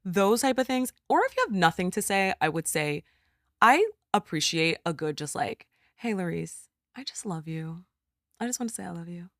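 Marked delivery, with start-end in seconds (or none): 6.0-9.3 soft, lightly acted affection; quiet, gentle imitation of a friend; tender check-in. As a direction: quote a caring friend, voice lowered.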